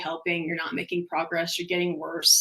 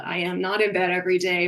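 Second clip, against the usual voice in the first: ordinary talk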